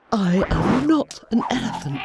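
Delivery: indignant shout stressing not; protest, hit not hard. Acted. Dorothy indignant at being called an elephant. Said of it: "said Dorothy indignantly".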